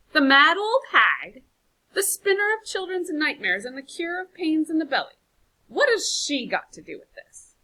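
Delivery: loud, high mocking contempt; scornful outburst. Brondon mocks suggestion to consult Sheba, loud contempt. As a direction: sneer at the very idea.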